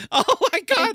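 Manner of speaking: high laughing exclaim. Real, not acted. Host cracks up at his own superhero boast, laughing. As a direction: burst out laughing at the joke.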